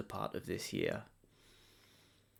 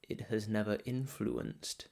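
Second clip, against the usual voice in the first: guided meditation; 4 dB quieter than usual. Real, not acted. Meditation guide settling listeners before the holiday chaos, calm guided meditation. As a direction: guide them gently inward, softer and unhurried.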